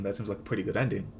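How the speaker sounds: ordinary talk, nothing marked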